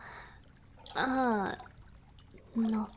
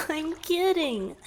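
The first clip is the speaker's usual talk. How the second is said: higher and louder, still laughing; 7 semitones higher, 6 dB louder than usual. Acted. Merman laughing off his flirty joke to his rescuer. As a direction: giggle it out.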